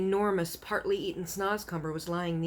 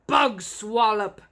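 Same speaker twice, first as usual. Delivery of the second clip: angry giant; 8 dB louder than usual. A narrator's reading. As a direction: roar the accusation. Giant accuses BFG.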